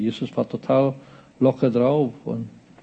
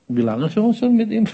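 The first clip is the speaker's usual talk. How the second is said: playfully imitating talk while munching; mock nonchalance breaking into laughter; relaxed, mouth full; 8 semitones higher than usual. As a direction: ask casually round a bite of apple. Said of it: "dass Jesus gerade so einen Apfel beißt".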